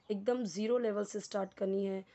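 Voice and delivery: ordinary talk, nothing marked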